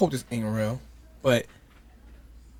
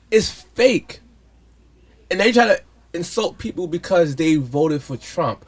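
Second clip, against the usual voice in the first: ordinary talk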